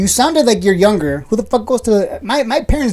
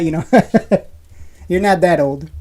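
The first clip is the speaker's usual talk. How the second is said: usual speaking voice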